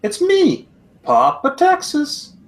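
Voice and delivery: very high, slow toy-doll voice; like a talking pull-string doll, very high. Pretend. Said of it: "he's supposed to be saying every five seconds".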